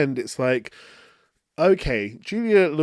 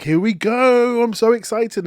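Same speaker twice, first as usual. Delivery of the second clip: giddy thrill, much higher and louder; excited; 8 semitones higher, 6 dB louder than usual. Retold or imitated. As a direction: gush like a fan in the cinema. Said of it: "And it's just like".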